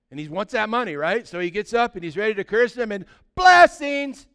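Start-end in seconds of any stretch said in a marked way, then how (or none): 3.3-4.3 high, loud, drawn-out blurt; involuntary mock shout. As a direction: blurt it out long and loud, against your will. Curse comes out as blessings.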